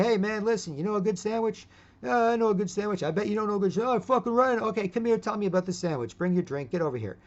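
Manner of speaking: high, chummy coaxing; playful buddy voice. Pretend. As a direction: coax the drunk over with a sandwich. Luring drunk away with sandwich talk.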